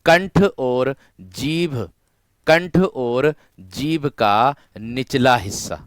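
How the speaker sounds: each word slow and weighted for students; कंठ और जीभ stretched and stressed; slow emphatic dictation. Shown as a demonstration. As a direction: spell it out slowly for the class. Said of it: "ये कंठीय व्यंजन किस किस से उच्चारित होंगे".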